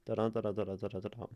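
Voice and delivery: fast, even run of weak-strong "Da-da" pairs; iambic patter. Shown as a demonstration. Teacher showing the way the syllables go, rapid iambic patter. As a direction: rattle off "Da-da-da-da" as a quick pulse. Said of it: "the way these syllables are".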